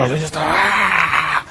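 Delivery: retold angry snarl, like a bristling dog; a mock furious cry, hackles up; angry growl. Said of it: "se les paran los pelos". (gruñido de enojo fingido)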